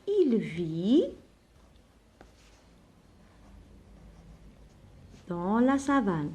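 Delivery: very slow, spaced dictation of il vit dans la savane; slow dictation pace for pupils copying. Shown as a demonstration. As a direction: dictate it word by word with long pauses. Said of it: "Donc, هنا شنقولو؟".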